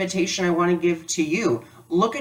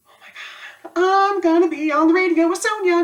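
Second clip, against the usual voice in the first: giddy disbelief; 14 semitones higher, 4 dB louder than usual. Retold or imitated. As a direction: gasp out the thrill of going on the radio. Realising she's getting on air.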